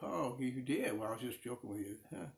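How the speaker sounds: ordinary talk, nothing marked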